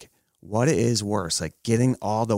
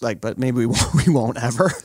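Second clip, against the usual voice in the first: normal talking voice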